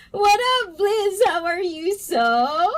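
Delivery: high, giggly greeting, still cracking up; real suppressed giggles; trying not to laugh. Real, not acted. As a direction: hold the laugh back and fail. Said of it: "I can't stop laughing".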